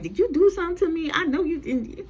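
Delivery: high, fast imitated confrontation; mock accusing. Angela confronting Claudette outside the store, mock accusing. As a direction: fire it off high, as an acted accusation.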